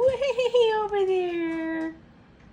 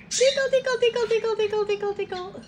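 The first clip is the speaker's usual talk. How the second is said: talking to a dog; 4 semitones higher, 4 dB louder, much faster than usual. Real, not acted. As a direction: sing it high, teasing and playful. Tickling pet again.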